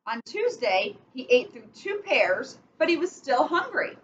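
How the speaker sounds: slow storybook reading with steady rhythm; picture-book read-aloud, measured and clear; reading to children. Acted. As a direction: read it like bedtime story time for kids. Reads picture book to kids.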